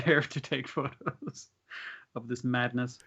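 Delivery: ordinary talk, nothing marked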